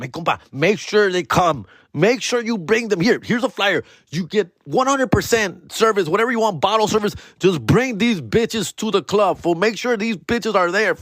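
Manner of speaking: slick promoter pitch. Retold or imitated. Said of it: "a guy with them".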